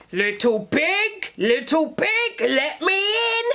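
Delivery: lightly voiced wolf coaxing at the door; wolf calling. Wolf calling to the first pig at his door. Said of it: "he called again".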